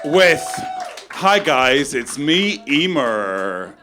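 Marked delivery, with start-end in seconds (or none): 0.0-0.4 hyped-up compere impression. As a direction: pump up the crowd like a cheesy host. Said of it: "I just did a really good impression of somebody".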